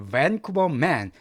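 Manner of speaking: Vancouver man read slowly and clearly; slow the headline down for learners; teacher reading a headline to students. Shown as a demonstration. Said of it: "뭐라고 돼 있습니까".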